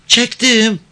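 put-on high-pitched girl's voice; high little reply; village girl. A narrator's reading. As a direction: answer him as a young village girl, pitched well up. Girl questioned by the man.

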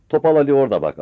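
loud high urging; urgent shout. Acted. Mother urges son to shoot Topal Ali, loud. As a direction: point him out urgently.

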